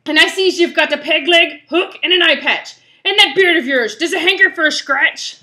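rally the crew as a rough pirate. Acted. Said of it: "doing this pirate voice".

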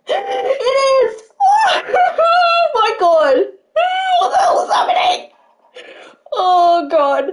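high laughing-crying shriek; hysterical joy. Real, not acted. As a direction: lose it completely, half laugh, half sob. Fan realizes idol liked tweet.

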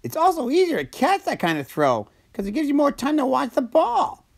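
very high cartoon sidekick voice; Slurpee. A narrator's reading. Slurpee catches up with Socko, high cartoon voice. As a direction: chatter in a high-pitched character voice. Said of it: "replied Slurpee".

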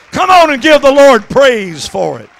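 usual speaking voice

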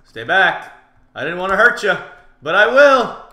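loud warning climbing in pitch; shouted warning. Real, not acted. Player warning off an opponent, rising shouted threat. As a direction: build to a high, loud threat.

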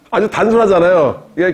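usual speaking voice

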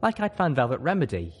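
ordinary delivery, nothing marked

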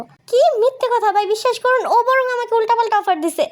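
high, urgent self-defence; desperate denial. Acted. Accused man begging bystander to believe him, desperate.

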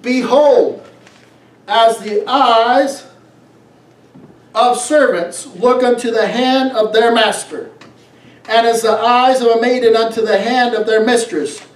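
ordinary delivery

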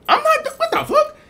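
very high squeal of disbelief; outraged squeak. Acted. Guy refuses the party, very high outraged squeak. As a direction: squeal it like you can't believe it.

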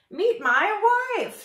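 very high, loud, drawn-out announcement; proud bird. A narrator's reading. Bird introduces his wife to the moose, proudly. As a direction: trumpet the introduction.

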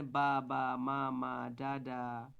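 "বা, বা, মা, মা, ডা, ডা" in long, even syllables; rhythmic babbling. Shown as a demonstration. Teacher showing babbling, "বা, বা, মা, মা, ডা, ডা" drawn out rhythmically. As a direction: chant "বা, বা, মা, মা, ডা, ডা" evenly, first syllables loud. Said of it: "তাল, রিদম আসে".

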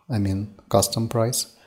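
usual speaking voice

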